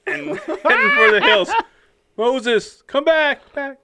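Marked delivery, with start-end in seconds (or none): 2.2-3.8 higher, louder mock call; calling after him. God calling fleeing Moses back, mock shout.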